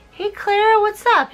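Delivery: high, breezy greeting; high casual boy. Retold or imitated. Nutcracker greeting Clara from offstage, high and breezy. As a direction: toss it off casually. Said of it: "he's just like".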